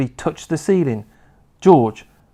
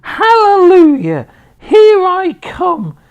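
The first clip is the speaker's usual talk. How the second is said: very high, very loud screechy crone whoop; wild old woman's shout; 9 dB louder, slower than usual. A narrator's reading. Grandma rising through the floor, screeching with glee. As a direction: whoop with mad joy.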